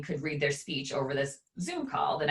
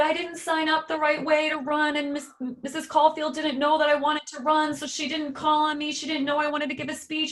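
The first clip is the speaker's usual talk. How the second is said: put-on high, far-off crying kid voice; imitating sobbing child; 11 semitones higher than usual. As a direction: play the upset little boy, tearful.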